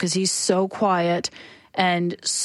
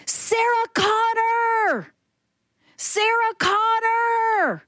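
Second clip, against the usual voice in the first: high, slow drawn-out call across the street; retold yell; 13 semitones higher, much slower than usual. Stranger calling her across the street, slow high yell. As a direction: call out the name long and high. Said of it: "This man across the street from me yells".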